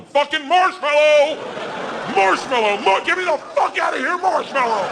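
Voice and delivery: high, panicked mock shriek for help; desperate scream. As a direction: yell frantically to be rescued. Desperate yelling for rescue from bear.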